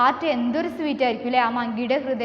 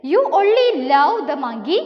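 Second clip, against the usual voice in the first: slow, loud, raised-pitch complaint; acted protest; 4 semitones higher, 8 dB louder, much slower than usual. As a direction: play the wife's reproach, stretched out. Wife quarrels with crocodile. (ഭാര്യയുടെ പരിഭവം നീട്ടി, ഉറക്കെ അഭിനയിച്ച്)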